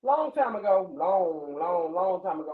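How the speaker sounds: long stretched out slowly each time; storyteller drawl. Acted. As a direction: draw out "long" like a fairy tale. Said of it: "So, starting the story".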